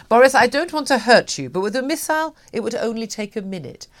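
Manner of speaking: Vladimir Putin. Retold or imitated. Presenter retelling Putin's phone threat to Boris, lightly voiced. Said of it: "Vladimir Putin said".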